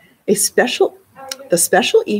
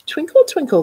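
sing-song teacher; 9 semitones higher, 4 dB louder than usual. A narrator's reading. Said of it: "said Missus Yamamoto".

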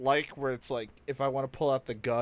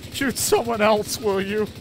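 high, loud mocking shout; mocking NPC voice; 7 semitones higher, 7 dB louder than usual. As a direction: mimic the NPC yelling back. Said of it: "the guy was like".

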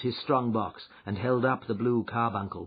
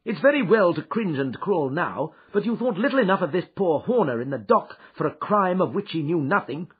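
Holmes, stern and cold, a little louder; 12 semitones higher, 9 dB louder than usual. Acted. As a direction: demand the truth without pity. Holmes interrogates Ryder.

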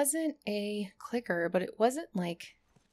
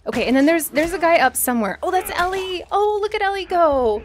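excited; 8 semitones higher, 13 dB louder than usual. Real, not acted. Excited watching Ellie fight.

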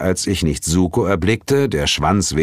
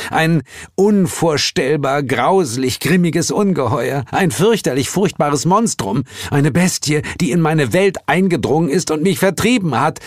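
high-pitched, overwrought description; Heel, dramatic dread; 9 semitones higher than usual. Acted. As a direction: hype up the horror in a high voice.

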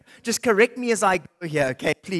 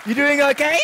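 normal talking voice

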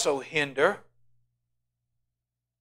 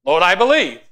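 loud and high, crying out; loud cry of faith; 5 semitones higher, 10 dB louder than usual. Acted. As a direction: cry it out to God. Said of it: "How can that be?".

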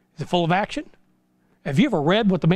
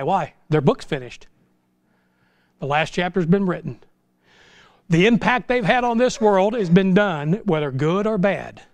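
ordinary talk, nothing marked

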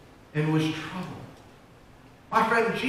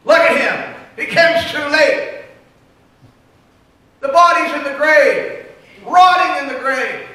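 high, loud, mocking jeer; derisive crowd; 7 semitones higher, 9 dB louder than usual. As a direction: sneer like the scoffing crowd. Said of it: "others looked at him in derision".

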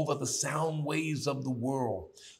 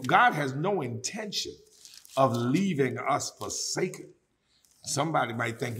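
ordinary talk, nothing marked

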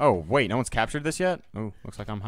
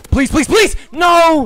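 desperate high screaming please; real scream; 15 dB louder, slower than usual. Real, not acted. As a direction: scream it in panic, pitch flying up. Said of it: "sorry to scream".

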